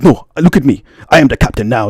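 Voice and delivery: put-on African-accented movie line, commanding; movie captain impression. Man compared to Tom Hanks movie hijacker, commanding African accent. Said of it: "you ever see that movie".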